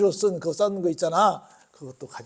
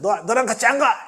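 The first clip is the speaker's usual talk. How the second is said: angry refusal; 5 semitones higher, 7 dB louder than usual. Retold or imitated. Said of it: "처음에는".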